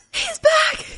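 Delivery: high-pitched squeal of excitement; re-enacted fangirl squeal, half laughing; thrilled. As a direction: squeal it like a fan seeing a hero return. Re-enacts thrill at Blade's return.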